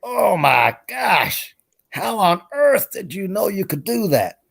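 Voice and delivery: high, very slow, drawn-out amazement; astonished Texan. Acted. Texan amazed at the man's drinking, astonished drawl. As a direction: stretch every word in hammed-up disbelief. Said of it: "Snake is looking at him and going".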